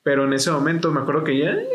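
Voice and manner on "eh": high, thin mock wail; imitated crying. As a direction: mimic her crying with one held high note. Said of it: "estaba llorando". (llanto imitado, agudo y sostenido)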